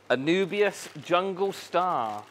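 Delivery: normal talking voice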